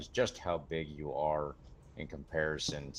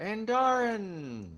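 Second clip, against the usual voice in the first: high, drawn-out fake call of a name; mock announcer yell; 6 dB louder, much slower than usual. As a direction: stretch the name out like a joke arena call. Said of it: "I'm kidding".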